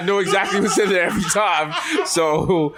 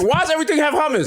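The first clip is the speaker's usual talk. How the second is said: high-pitched incredulous complaint; hammed-up outrage edged with laughter; exasperated customer; 11 semitones higher than usual. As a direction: wail it in mock despair.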